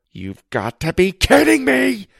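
high, loud cry of disbelief; can't believe what he sees; shocked disbelief. A narrator's reading. Boy staring at his own burger belly, shocked cry.

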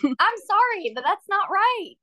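objecting in a high, insistent voice; high-pitched protest. Real, not acted. Co-host objects to taking her back to the house, high-pitched protest. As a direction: pitch it right up as you object.

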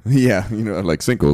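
usual speaking voice

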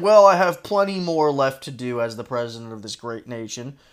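a hint of a presidential tone; quoting the AI president. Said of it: "He said".

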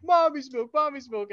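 put-on high, whiny childish chant; whiny kid. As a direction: beg like a clingy little boy. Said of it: "he's like".

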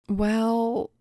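hesitant, stretched opener; stalling. A narrator's reading. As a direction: stall for time on one held word. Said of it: "stalled Paul".

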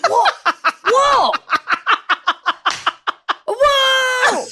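high "whoa", stretched longer each time; extended anime dub. Acted. Co-host mocking dubs that extend sounds, high stretched cry. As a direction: build to a loud drawn-out cartoon "whoa". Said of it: "they just have to extend it in the dub".

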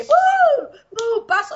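high, loud whoop to a step; an upbeat instructor calling the step; mock dance class cue. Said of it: "mis clases eran como que".

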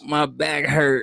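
whiny complaint. Pretend. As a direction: drag out a lazy excuse. Mock complaining excuse.

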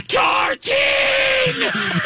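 high, drawn-out anguished cry; hammed-up mock despair; overdramatic dad. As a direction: bellow her name like a terrible actor. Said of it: "there's over dramatic acting with the, the dad".

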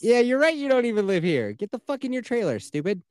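high-pitched jeering put-down; play-scold the absent rival, pitched high; mock taunt. Listener backing up the storyteller against the cousin, mock taunting.

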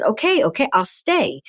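high-pitched giving in; retold exasperated surrender; placating. Gives in to worked-up mother.

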